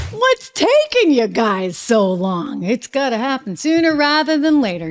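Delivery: hammed-up pestering, stretching the words; mock impatient paraphrase. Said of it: "he's like".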